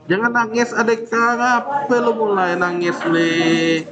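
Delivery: teasing a student like a whiny little sister; mock comforting a crying kid. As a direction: coo at them as if they're about to cry.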